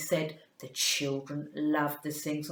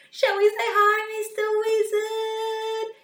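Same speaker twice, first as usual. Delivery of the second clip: excited call; 6 dB louder, much faster than usual. Acted. Greeting the puppet, loud.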